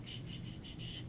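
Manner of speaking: quiet, gentle shh sounds; whispered shushing, barely there; soft shushing. Shown as a demonstration. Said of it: "So I'm not talking about, like".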